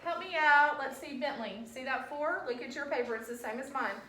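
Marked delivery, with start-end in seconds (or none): 0.0-0.8 louder call to the class; rally the room. Real, not acted. Teacher calling class to help answer.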